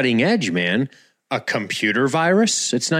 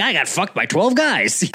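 mock boasting; 7 semitones higher than usual. Mimicking boastful girl.

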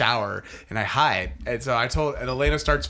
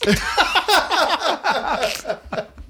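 big laugh; 14 semitones higher than usual. Real, not acted. Laughing at son's remark.

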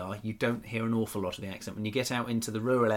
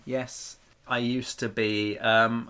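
ordinary talk

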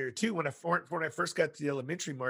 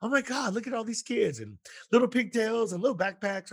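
lightly voiced awed nature-show gawk, higher; National Geographic mode; 7 semitones higher than usual. New counselor gawking at kindergartners, higher nature-show awe. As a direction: marvel at the kids like at the zoo, pitched up. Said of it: "in this National Geographic mode".

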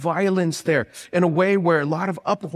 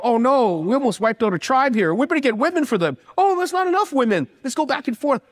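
mock panic; 7 semitones higher than usual. Retelling Israelites' panicked plans.

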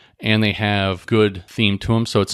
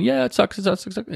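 much higher, wild griping singsong; crazy person; 11 semitones higher than usual. Retold or imitated. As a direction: squeal it like a madman jogging down the street. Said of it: "look like a crazy person running down the street going".

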